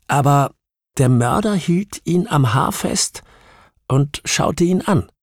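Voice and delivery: high-pitched, halting testimony; distressed witness, intent. Acted. Witness correcting her questioner about the killer, high and halting. Narrator voices Sophia recounting the shooting.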